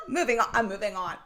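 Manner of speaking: very high laugh then fast giggled words; laughing fit. Real, not acted. Coach cracking up before rushing back to topic.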